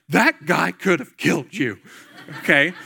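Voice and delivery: mock a horrified mom's warning; alarmed mom. Mom hearing about the stranded stranger, mock alarm. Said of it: "my mom's like".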